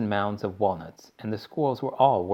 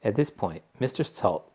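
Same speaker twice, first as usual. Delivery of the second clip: ordinary delivery, nothing marked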